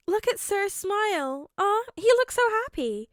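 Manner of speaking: put-on high, fond girl voice; cooing. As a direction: coo over the picture. Hando cooing.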